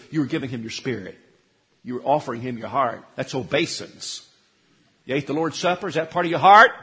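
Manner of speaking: usual speaking voice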